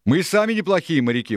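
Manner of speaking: high indignant retort; Dick, objecting. A narrator's reading. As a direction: object loudly, pitch jumping up. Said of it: "возразил Дик".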